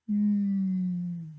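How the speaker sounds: a sad cow mooing; weepy moo. Acted. Cow weeps.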